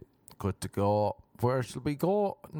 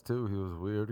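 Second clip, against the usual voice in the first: normal talking voice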